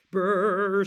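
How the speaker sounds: loud, rolled bear shiver; big cold shudder; shivering brrr. A narrator's reading.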